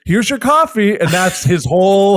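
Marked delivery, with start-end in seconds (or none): 0.1-1.0 barista line. Retold or imitated. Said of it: "he's the guy who goes".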